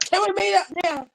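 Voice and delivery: high, pestering little plea; whiny pleading. Retold or imitated. Pesters her friend to meet, high and whiny. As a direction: nag like a pushy friend.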